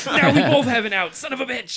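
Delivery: loud, high comic rant; hammed-up exasperated yell; mock frustrated outburst. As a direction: blow up as the foiled schemer. Acting the characters' foiled-plan outburst.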